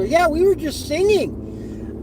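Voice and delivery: high, drawn-out protest; passenger piping up. Retold or imitated. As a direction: pipe up from the back seat. Passenger pipes up from the back.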